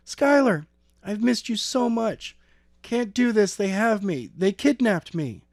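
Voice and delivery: put-on high girl's voice, urgent and scared; frightened girl. A narrator's reading. Kidnapped girl telling Skylar on the phone, high and urgent.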